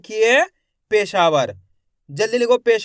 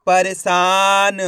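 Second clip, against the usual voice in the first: परेशान stretched out very slowly as one word; slow blended reading of परेशान; 4 dB louder, much slower than usual. Shown as a demonstration. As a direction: draw out परेशान slowly, joining the syllables. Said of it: "एक एक अक्षर का उच्चारण करके".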